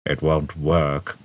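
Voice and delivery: slow, low, lifeless drone; droning. A narrator's reading. Marvin the robot droning to Trillian. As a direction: drag it out with no hope. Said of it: "droned Marvin".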